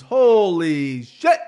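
slow, stretched holy shit; drawn-out awe. Real, not acted. Podcaster hyped over the fight card, slow and awed. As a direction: savor it in amazement.

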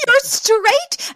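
very high-pitched incredulous question; mock outraged squeal of disbelief; indignant. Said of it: "She is so indignant".

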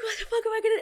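high, wailing mock panic; hammed-up tearful freak-out. Teen retelling her meltdown over the passport, mock hysterical wail. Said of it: "I start freaking out".

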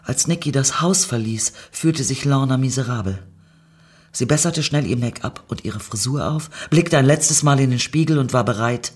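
plain reading voice

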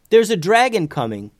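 high, loud urgent warning. A narrator's reading. Boy warning Tubes of a dragon, urgent and high. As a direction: call out the alarm.

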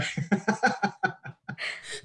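high breathless laughter; airy laugh. Real, not acted. Cracking up at the Rafa bit, airy high laughter. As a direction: break up laughing, almost silent. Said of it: "[name]'s lost it".

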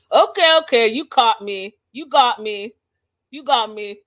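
loud burst, easing as it repeats; hammed-up admission of guilt; mock surrender. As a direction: give in loudly, imitating a man caught out. Retold caught-out admission, loud then easing.

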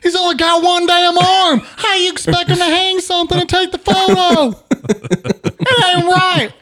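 very high, loud mock-outraged holler; outraged defender. Mock outraged defender of one-armed man.

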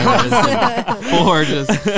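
laughing. Real, not acted. Host laughing while mocking the huge studio setup. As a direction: crack up while listing the gear.

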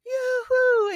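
high, loud mock crowd cheer. Runner imitating the crowd at the finish, cheering. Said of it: "they all started cheering me in".

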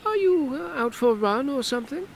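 amused woman's jab; Hortense, teasing. Acted. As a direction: needle him about his sweating. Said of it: "she asked".

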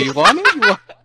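teasing dog voice. Retold or imitated. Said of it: "what you do with the dogs".

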